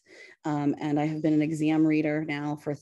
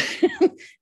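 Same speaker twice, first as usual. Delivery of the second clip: nervous laugh; 6 dB louder than usual. Real, not acted. Laughing after nervousness admitted.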